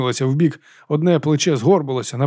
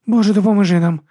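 high, loud frightened squeal; squeak; 7 semitones higher, 5 dB louder than usual. Acted. Monk crouched beside her, squeaking. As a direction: squeak out a desperate prayer. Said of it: "пискнув брат Діас".

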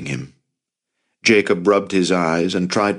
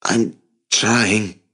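strained, anxious protest; a touch of anxiety while struggling; 3 semitones higher than usual. A narrator's reading. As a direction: answer anxiously under pressure. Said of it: "the anxiety in his voice clearly audible".

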